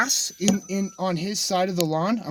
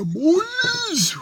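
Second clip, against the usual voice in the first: high sing-song call. Real, not acted. Calling out to viewers.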